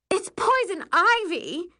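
ordinary delivery

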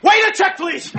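loud, high shout for service; urgent call. Acted. Husband calls for the check, urgent shout. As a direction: call out frantically across a restaurant.

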